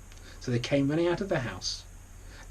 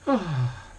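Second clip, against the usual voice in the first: high, delighted ahh; blissful sigh; 5 semitones higher, 4 dB louder than usual. A narrator's reading. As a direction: breathe it in with pleasure. Pig inhales the scent.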